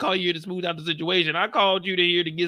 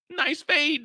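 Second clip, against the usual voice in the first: ordinary talk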